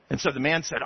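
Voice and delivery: ordinary delivery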